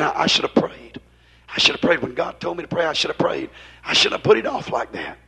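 low, softened voice of regret; imitated inner regret. Preacher voicing a churchgoer's regretful thoughts, low and subdued. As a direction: quietly voice someone's private regret.

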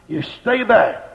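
ordinary delivery

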